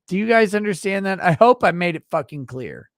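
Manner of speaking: half-joking yell at chat; mock shout. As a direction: drive the point home, hammed up. Ranting at chat about grift.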